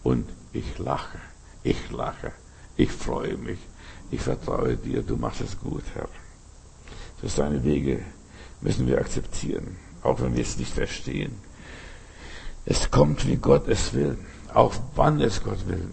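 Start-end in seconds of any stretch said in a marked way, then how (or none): 0.0-11.3 soft, low, half-whispered prayer; quiet prayer. Acted. Preacher praying quietly to God, hushed prayer. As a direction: pray it quietly and trustingly.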